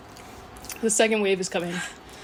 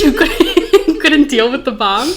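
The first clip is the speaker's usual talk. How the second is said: loud laughing; 5 semitones higher, 10 dB louder, much slower than usual. Real, not acted.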